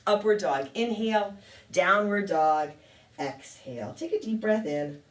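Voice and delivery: ordinary delivery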